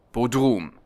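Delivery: usual speaking voice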